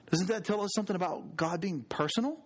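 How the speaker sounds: usual speaking voice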